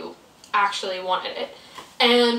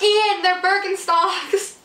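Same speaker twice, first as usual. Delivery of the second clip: mock retort; 10 semitones higher, 5 dB louder, much slower than usual. Narrator retorts to Ian.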